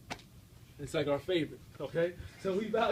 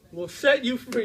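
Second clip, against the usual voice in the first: higher and louder on set you free; proclaimed climax; 4 semitones higher, 7 dB louder, slower than usual. Acted. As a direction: ring out the promise to the crowd. Climax of quoted verse.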